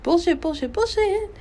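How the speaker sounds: high, scornful repeated scoff. Real, not acted. Dismissing his words to her listeners, scornful. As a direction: spit it out with contempt, pitch climbing.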